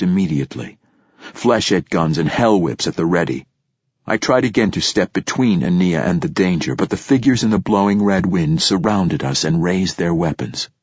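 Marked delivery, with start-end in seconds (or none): none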